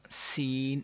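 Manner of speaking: normal talking voice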